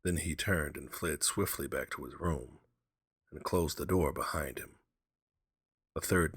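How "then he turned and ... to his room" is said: ordinary delivery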